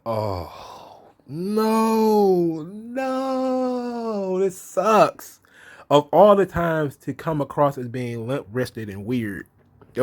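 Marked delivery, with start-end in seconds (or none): 0.0-5.4 mock despair. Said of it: "I was like".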